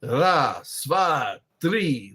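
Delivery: Russian one-two-three, each number punched out; Russian counting. Shown as a demonstration. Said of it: "That's how you say it in Russian".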